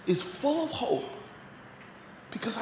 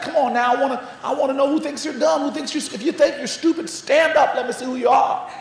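higher, faster mock-upset Southern teacher; impatient; 5 semitones higher, 5 dB louder than usual. Upset teacher repeating herself to a silent class, acted impatience. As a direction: demand it impatiently, rising to a loud "stand up". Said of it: "standing there impatiently".

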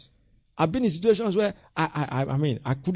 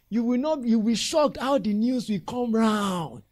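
ordinary talk, nothing marked

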